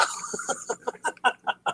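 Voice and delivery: breathy, high chuckle; soft wheezing giggle at your own joke; laugh. Real, not acted. Telling viewers a corny dad joke, laughing.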